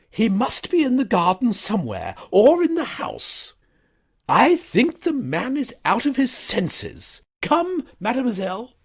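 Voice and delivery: high, loud, indignant lady; scandalised, pressing the complaint; stern schoolmistress reporting a scandal; outraged headmistress. A narrator's reading. Headmistress voiced.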